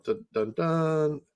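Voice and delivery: ordinary delivery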